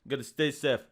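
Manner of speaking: low, badly faked Austrian accent; Arnold Schwarzenegger. Film star telling viewers to stay home, low mock Austrian accent. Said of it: "like, the Terminator face".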